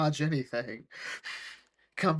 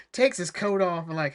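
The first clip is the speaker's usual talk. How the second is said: ordinary talk, nothing marked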